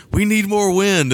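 high, drawn-out mock call; mock director's demand. Imagined director calling to the crew, high mock demand.

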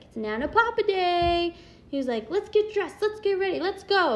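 put-on high, eager little kid voice; imitating excited son. Mother imitating son eager for grandparents' day, high and excited. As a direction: bounce it like an excited four-year-old. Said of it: "And he was so excited".

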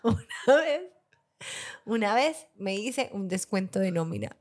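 ordinary delivery

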